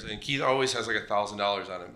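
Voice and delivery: normal talking voice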